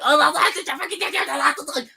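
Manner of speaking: high, loud sneering mock voice with a laugh; mocking whiny hater. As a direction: parody a shrill hater, laughing. Mocking negative fans.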